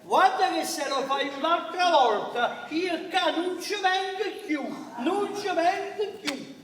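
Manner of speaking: slow, loud warning; angry threat. Acted. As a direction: lay down the law, firmly. Threatening not to come back.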